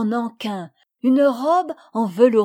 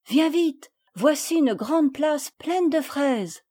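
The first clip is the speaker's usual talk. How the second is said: put-on higher child voice, slow and delighted; excited child; 6 semitones higher than usual. A narrator's reading. Madeleine shows Camille the strawberries, excited child voice. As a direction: share the find. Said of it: "s'écria Madeleine".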